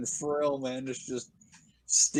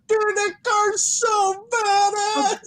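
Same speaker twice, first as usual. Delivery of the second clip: much higher, slow and louder; silly mock voice; 6 dB louder, slower than usual. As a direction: play an awestruck car nerd. Imagined quote, high silly voice.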